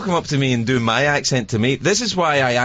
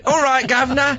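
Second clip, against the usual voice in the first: higher laughing mock Cockney; mock British accent; 11 semitones higher than usual. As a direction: toss off a fake Cockney greeting. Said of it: "she suddenly had a British accent".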